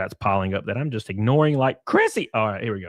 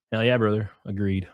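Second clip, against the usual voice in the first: ordinary talk, nothing marked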